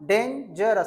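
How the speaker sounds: डेंजरस said as three distinct syllables; teacher separating each syllable of डेंजरस; split into three parts. Shown as a demonstration. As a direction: sound out डेंजरस beat by beat. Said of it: "तीन भागों में पढ़ देना".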